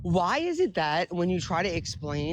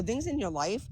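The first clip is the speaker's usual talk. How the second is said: ordinary delivery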